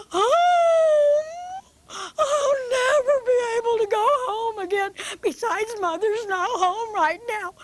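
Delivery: a little bunny sobbing out her story; crying bunny. Acted. Betty Bunny tells Buster Bear about her lost tooth, sobbing high.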